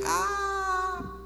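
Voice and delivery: high, sustained "aah" wail; mock crying wail. Rock split in half on landing, mock crying wail. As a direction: cry out high in pretend pain. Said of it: "the rock was crying".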